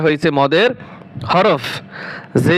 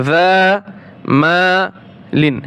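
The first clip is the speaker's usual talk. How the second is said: যা Mullin split with a pause, Mullin very slow; slow, syllable-by-syllable model; broken into syllables; much slower than usual. Shown as a demonstration. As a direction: break যা and Mullin apart, slowly. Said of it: "আমি ভেঙে ভেঙে বলছি".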